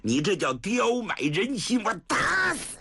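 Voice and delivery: scorn her, then explode; vicious abuse. Acted. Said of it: "薛丁山又来劲儿了". (恶狠狠地骂到狂吼)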